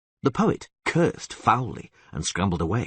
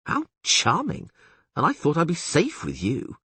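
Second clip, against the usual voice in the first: sarcastic bard; 8 semitones higher than usual. Acted. Dandelion sarcastic.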